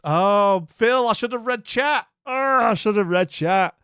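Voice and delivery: loud, high cry of frustration; exasperated self-reproach. Real, not acted. Streamer realises chat had the answer, exasperated outcry. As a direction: kick yourself loudly for missing it.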